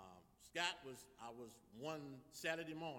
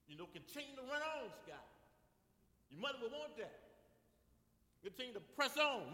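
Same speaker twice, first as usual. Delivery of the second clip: high, loud, rousing pulpit exhortation; impassioned preacher, punching the key phrases high; preaching at full force; 7 semitones higher, 7 dB louder than usual. Real, not acted. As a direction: preach it with rising fervour, urging a brother on.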